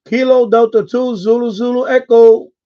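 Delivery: high and loud callsign shout; loud radio call. Real, not acted. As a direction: push the callsign through the noise. Calling out own callsign.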